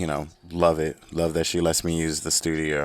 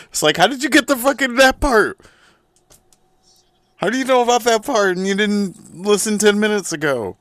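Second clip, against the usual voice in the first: very high, loud, fast incredulous squeal; squeaky mock outrage; 7 dB louder than usual. Comedian mocking listeners who skipped ahead, high squeal. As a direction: squeal it in hammed-up disbelief.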